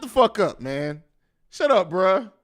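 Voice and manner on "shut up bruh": ordinary delivery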